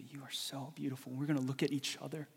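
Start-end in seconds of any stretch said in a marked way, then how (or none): 0.0-1.1 softer tender voicing of God; tender God quote. Preacher voicing God softly to the listener. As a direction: say it gently, like a loving father. God's gonna look at you and say.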